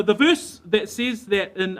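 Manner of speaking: ordinary delivery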